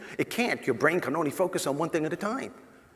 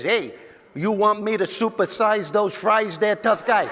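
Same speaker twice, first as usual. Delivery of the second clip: hammed-up New York tough-guy voice, higher and louder; New York attitude; 6 semitones higher, 6 dB louder than usual. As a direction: play the cocky New York toll booth guy, laughing. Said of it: "with the biggest New York attitude and says".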